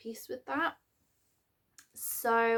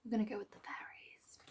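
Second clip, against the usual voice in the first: whisper; 10 dB quieter than usual. Real, not acted. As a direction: whisper it, as if deciding under your breath. Whispered aside choosing the deck.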